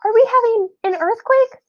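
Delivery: high worried squeaky question; alarmed squeak. A narrator's reading. Quentin frightened asks the class about the quake. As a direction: sound startled and small.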